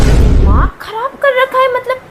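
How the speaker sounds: very high, loud exasperated cry, half laughing; frustrated outburst. Acted. Didi loses it at the pestering kid, high and loud. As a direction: explode in exasperation.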